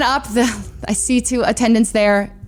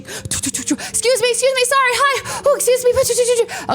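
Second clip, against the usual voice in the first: high-pitched, hurried little voice; frantic apologizing; 13 semitones higher, slower than usual. Retold or imitated. Traveler sprinting through airport crowd, high frantic apologies. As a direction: squeeze past people with high, frantic apologies.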